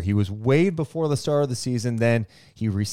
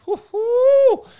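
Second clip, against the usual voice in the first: high, loud cheering whoo-hoo; excited whoop; 9 dB louder than usual. Acted. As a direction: whoop it up with a squeal. Cheers in the middle of the ad.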